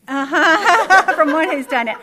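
loud laughing reply; big knowing laugh. Real, not acted. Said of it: "Get the surgery".

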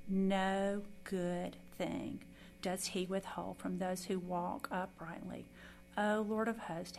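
loud, measured psalm reading; scripture reading. Acted. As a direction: proclaim each verse solemnly. Reads Psalm 84 aloud.

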